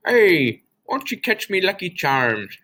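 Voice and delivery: a self-admittedly terrible leprechaun cereal-mascot voice, way up high. Pretend. Teacher jokes a bad leprechaun voice for Saint Patrick's Day, high and put-on. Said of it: "like, a leprechaun voice".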